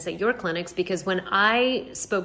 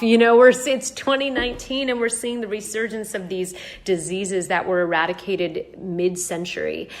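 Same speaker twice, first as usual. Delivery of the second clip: ordinary delivery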